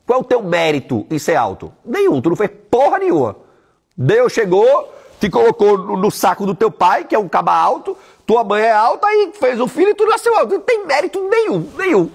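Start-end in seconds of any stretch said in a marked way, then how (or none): 1.9-12.1 louder, rising heated rant. Real, not acted. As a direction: hammer the point home, worked up. Ranting about tall people's merit.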